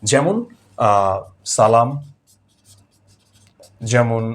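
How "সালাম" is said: সালাম with a clear s, as "salam"; hissing s. Shown as a demonstration. Teacher gives a foreign-word example, সালাম with hissing s. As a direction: dental hiss opening সালাম, not sh. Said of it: "এটা আমরা সাধারণত পাই বিদেশি শব্দে".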